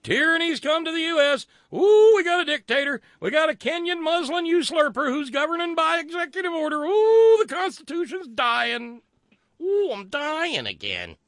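high, loud wailing hysterics; mock screaming. Host imitating right-wing pundits crying tyranny, mock screaming. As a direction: shriek it like a hammed-up panicked protester.

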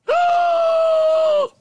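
loud, very high shocked gasp; hammed-up scandalized gasp; horrified gasp. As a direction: gasp in comic horror. Husband reacts in horror.

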